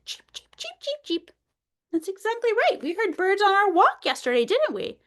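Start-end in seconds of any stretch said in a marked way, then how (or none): 0.0-1.3 bird chirps. Shown as a demonstration. Bird sound for kids.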